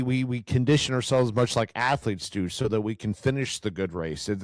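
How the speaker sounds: ordinary talk, nothing marked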